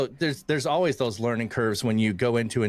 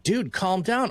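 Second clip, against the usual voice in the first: higher-pitched mock plea to calm down; imitated wife's admonishment; 8 semitones higher, slower than usual. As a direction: play a wife reining in her husband. Wife voiced telling him off.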